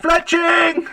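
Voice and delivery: high, loud shout for a helper; hearty, high-pitched holler; yelling out. Acted. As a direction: yell the name to the back of the store. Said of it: "both at the same time yell out".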